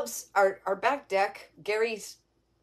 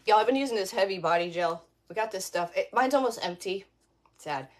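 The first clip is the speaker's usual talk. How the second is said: ordinary delivery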